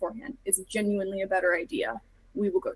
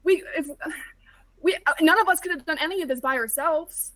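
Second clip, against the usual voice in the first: high, loud, tumbling urgency; impassioned plea; 9 semitones higher, 7 dB louder than usual. Acted. Arlo begging Silas not to go alone, impassioned and high. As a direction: plead with everything.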